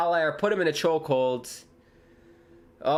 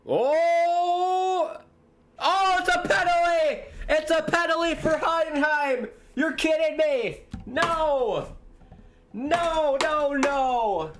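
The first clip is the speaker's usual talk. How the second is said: much higher, disbelieving cries of oh and no; real outrage at a shock penalty call; shocked outcry; 13 semitones higher, slower than usual. Real, not acted. Penalty given against his wish.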